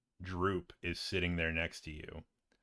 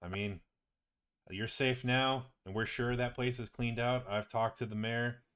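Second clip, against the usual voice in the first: lilting, animated character voice, a bit higher; chatty wizard companion; 4 semitones higher than usual. Acted. Visiting friend tells patient about the treasure, chatty and animated. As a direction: rattle off the loot with rising enthusiasm.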